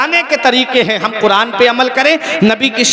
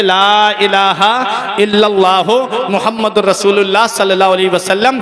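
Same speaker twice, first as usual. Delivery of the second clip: religious recitation. Real, not acted. Preacher leading everyone in kalima, solemn recitation. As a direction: lead the congregation through the creed.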